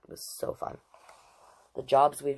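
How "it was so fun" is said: whispered aside. Real, not acted. Remembering driving a Bobcat.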